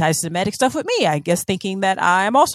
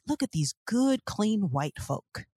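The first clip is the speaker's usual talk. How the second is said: mock wholesome; 9 dB quieter, slower than usual. Mocking a wholesome TV show.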